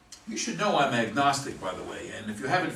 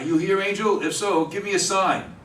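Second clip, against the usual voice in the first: louder call into the empty room; 4 semitones higher, 7 dB louder than usual. Acted. Joe alone calling out to the angel, louder.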